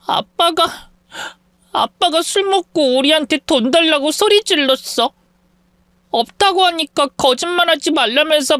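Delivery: put-on high, teary child's voice, sobbing out the words; a frightened little girl crying as she tells it. Scared child tells mother about father, crying. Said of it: "엉엉 울기 시작했어요".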